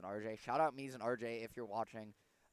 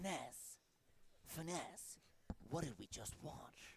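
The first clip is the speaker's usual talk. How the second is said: Snake impression; 6 dB quieter, slower than usual. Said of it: "I do a Snake impression".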